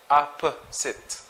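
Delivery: Opposite slowly, in three separate syllables; slow teacher's demo of Opposite, syllable by syllable; syllabicated. Shown as a demonstration. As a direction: break "Opposite" into ah, puh, sit. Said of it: "Let's try to syllabicate".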